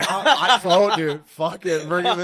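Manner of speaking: loud, high burst of laughing enthusiasm; excited yelling. Real, not acted. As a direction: jump in loudly, all for it. Excited laughter about guest idea.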